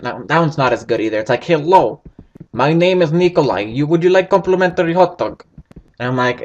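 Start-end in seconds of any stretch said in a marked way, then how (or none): 1.4-5.4 hammy, amateur Russian accent. Shown as a demonstration. Gamer demonstrating a weak character voice, Russian accent. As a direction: put on a rough Russian accent. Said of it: "my Russian accent comes second".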